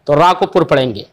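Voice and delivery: normal talking voice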